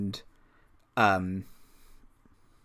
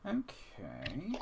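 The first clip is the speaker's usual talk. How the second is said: normal talking voice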